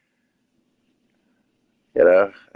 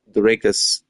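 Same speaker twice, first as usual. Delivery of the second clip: usual speaking voice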